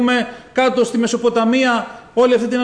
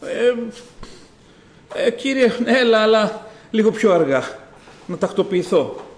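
hesitant, stalling plea with long pauses; tentative, halting, trailing away; mock half-hearted call. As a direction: play a reluctant believer asking for a delay. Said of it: "μια ζωντανή νύμφη".

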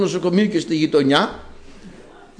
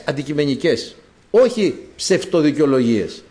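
ordinary talk, nothing marked

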